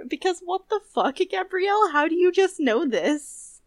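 high-pitched, baffled demand; incredulous. Real, not acted. Co-host marvels at what Gabrielle knows, incredulous. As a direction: ask it in exasperated amazement, pitched high.

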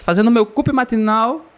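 ordinary talk, nothing marked

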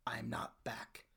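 soft, low, slow with heavy pauses; solemn instructions. Acted. Son telling Dad when to open the box, solemn. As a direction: weigh each phrase, hushed.

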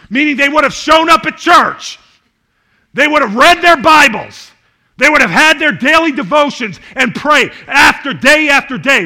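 loud, high preaching shout; sermon climax with slowed punchlines; preaching at full force. Acted. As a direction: drive it home to the congregation, shouting.

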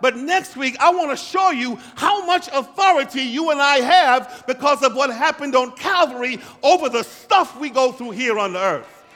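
loud, high, driving sermon voice; fired-up pulpit delivery; full-force preaching. Acted. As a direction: preach it out to the back row.

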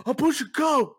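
loud high mock shout; deadpan yell. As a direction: bark it with a straight face. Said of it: "He just".

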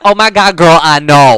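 put-on loud, playful girlfriend gush; mock gushing agreement. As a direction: play at commiserating with big enthusiasm. Imagined gleeful agreement with aunt.

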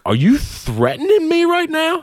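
high, shocked challenge; stunned, calling the threat out; incredulous. Retold or imitated. Confronting the knife-waving boss, high and shocked.

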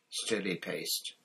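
plain reading voice

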